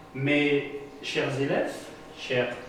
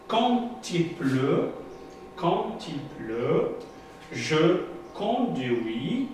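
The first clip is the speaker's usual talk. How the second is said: slow reading aloud; 2 semitones higher, much slower than usual. Shown as a demonstration. Teacher reading the textbook sentence to pupils, slow. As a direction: slowly read "quand il pleut, je conduis" word by word. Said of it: "Qu'est-ce que la phrase dit?".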